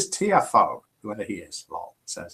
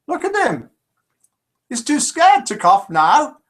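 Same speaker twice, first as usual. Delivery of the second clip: much higher, louder breezy retort; cheery mock assistant; 11 semitones higher, 5 dB louder than usual. Assistant answering the pharmacist, chirpy high retort. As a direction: brush the scolding off, chirpy and high. Assistant delivers punchline in joke.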